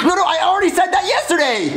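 high-pitched exasperation; rattled high insistence; frantic protest. Acted. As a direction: cut in, desperate to be believed. Boy protests the repeat.

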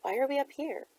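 hushed, high-pitched gasp; softly gasped, lightly acted; gasped question. A narrator's reading. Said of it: "Jenna gasped".

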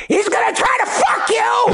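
high, shrieking parrot squawk; piercing cartoon parrot yell. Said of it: "and the parrot goes".

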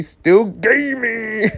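high, slow rising catchphrase; gleeful hype. Acted. Reader wondering if roommate gamed all night, gleeful hype. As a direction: draw it out high and teasing.

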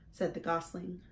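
usual speaking voice

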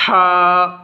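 ہا from the throat; throaty ha. Shown as a demonstration. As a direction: push ہا up from the throat. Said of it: "ہا کی حلق سے آواز آئے گی".

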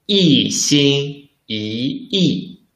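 一心一意 drawn out slowly, 一 in fourth then second tone; slow careful model of 一心一意 for learners; teacher slowly modelling 一心一意 for students; slow tone drill. Shown as a demonstration. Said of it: "So we should read".

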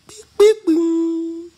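very high, loud beeping chime; airport chime imitation. Comedian imitating the airport chime before the announcement. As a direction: mimic the announcement tone.

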